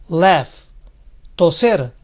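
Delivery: ordinary talk, nothing marked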